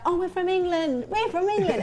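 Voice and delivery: high, loud, eager protest; mock naive pleading. English lads to a stranger on the subway, high mock-naive pleading. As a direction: imitate clueless tourists pleading their case.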